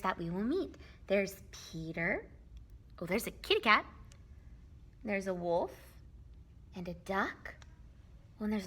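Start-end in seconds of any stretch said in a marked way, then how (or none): none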